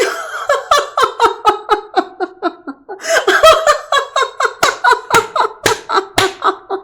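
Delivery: long high giggling cackle; gleeful rabbit laugh. Acted. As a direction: laugh like a trickster who won. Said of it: "Rabbit started laughing and laughing".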